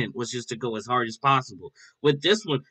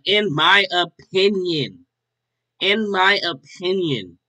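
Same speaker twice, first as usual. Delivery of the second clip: "in my opinion" drawn out slowly and raised, falling away; emphatic stress; 4 semitones higher, 3 dB louder, slower than usual. Real, not acted. Reactor underlining that it is only his view, slow and emphatic. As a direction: stretch "in my opinion" word by word, pitched up. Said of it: "emphasis on the".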